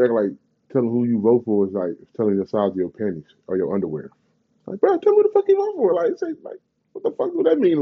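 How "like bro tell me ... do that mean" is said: exasperated squeal. Real, not acted. Ranting at people who hide their vote, high exasperated squeal. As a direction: voice shoots far higher in disbelief. Rant about hiding votes.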